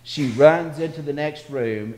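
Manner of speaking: usual speaking voice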